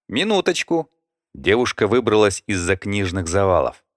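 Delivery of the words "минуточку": put-on girl voice. A narrator's reading. Librarian girl voice.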